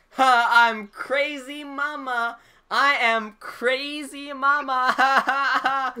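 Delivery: high, very slow mock drunken wail; drunk lady. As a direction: imitate a drunk woman loudly proclaiming herself, drawn out. Imitates drunk lady in the lift.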